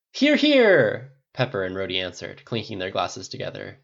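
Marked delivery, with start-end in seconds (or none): 0.1-1.1 high, loud answer to a toast; rousing cheer. A narrator's reading. Friends clinking glasses after Tony's toast, rousing cheer. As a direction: cheer in hearty agreement.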